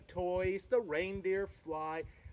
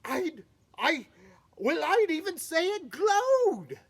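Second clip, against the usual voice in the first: amazed; 9 semitones higher, 7 dB louder than usual. Acted. Donner still amazed.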